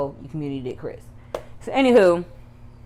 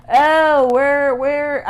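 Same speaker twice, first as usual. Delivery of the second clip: nosy coworker voice; 9 semitones higher, 9 dB louder, much slower than usual. Retold or imitated. Voicing the hater coworker.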